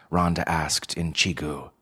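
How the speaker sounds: ordinary delivery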